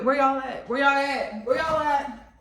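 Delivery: high, loud repeated holler; calling out. Real, not acted. Repeated call-out to missing chefs.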